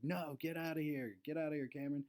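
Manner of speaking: imitating the league manager ordering him off the court; retold yell. Manager kicking a teammate out of the game, mock yell. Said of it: "he yelled".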